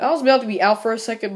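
ordinary talk

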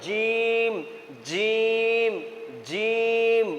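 জিম with a firm, thick j and long held vowel; thick, heavy. Shown as a demonstration. Teacher models the letter for students, জিম thick and held. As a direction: press জিম out heavy and drawn out. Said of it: "জিমকে মোটা করে পড়তে হবে".

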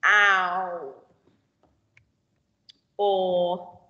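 put-on clear, isolated vowel sounds for children; phonics drill. Shown as a demonstration. Teacher drilling vowel sounds to pupils, crisp and clear. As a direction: sound out each vowel slowly for the class.

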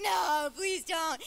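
loud, drawn-out mock plea; mock pleading. Preacher retelling her plea to the pastors, mock pleading. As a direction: beg them, slow and loud.

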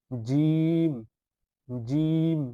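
teacher pressing hard on জিম so students hear it; forceful jim. Shown as a demonstration. Teacher demonstrating a firm, forceful জিম. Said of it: "শক্তভাবে বলতে হবে".

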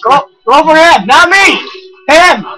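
high, loud frantic directing; urgent calling out. Real, not acted. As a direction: yell to redirect the attacker. Calling enemy onto companion.

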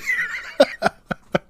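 a sudden high-pitched laugh; high burst of laughter. Real, not acted. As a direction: burst out laughing right after the punchline. Laughing after the punchline.

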